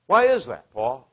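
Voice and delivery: normal talking voice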